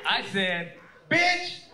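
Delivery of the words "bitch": high, loud retold outburst; hammed-up enraged yell; mock angry shout. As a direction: yell it as one sharp word. Husband turns on wife, yelling.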